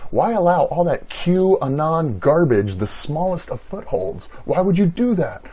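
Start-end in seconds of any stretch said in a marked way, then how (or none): none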